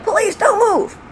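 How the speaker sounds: high-pitched shouted command; police yell. Retold or imitated. Police recruit at targets on the range, yelling. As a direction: call it out as a drilled order. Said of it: "we'd have to yell".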